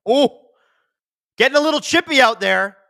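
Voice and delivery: hockey fan yelps as tempers flare; excited exclamation. Real, not acted. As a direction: jump up as a scrap breaks out. Sudden scuffle on the ice.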